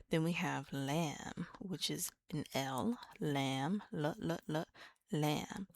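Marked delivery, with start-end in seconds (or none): none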